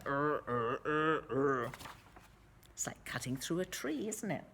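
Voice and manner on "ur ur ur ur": low strained sawing grunts. Retold or imitated. Narrator mimics men sawing with low grunts. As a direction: grunt like pushing a saw.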